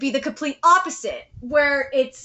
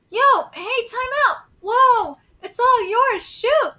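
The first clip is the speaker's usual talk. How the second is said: high-pitched playground shouts, lightly acted; calling out; 9 semitones higher than usual. Kids calling during a soccer game, high and urgent. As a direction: toss out quick calls to teammates.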